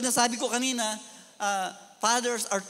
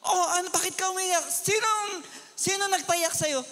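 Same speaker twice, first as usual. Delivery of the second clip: higher, demanding who did it; angry questioning; 8 semitones higher than usual. Retold or imitated. Pastor angrily asking crying girl who made her cry. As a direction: confront the crying girl sharply. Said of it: "Nagalit ako".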